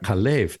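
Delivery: Kalev with the accent on the second syllable; stress on second syllable. Shown as a demonstration. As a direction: lean on the last syllable of Kalev. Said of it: "the accent on the second syllable".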